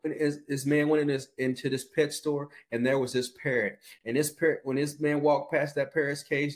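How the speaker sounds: ordinary delivery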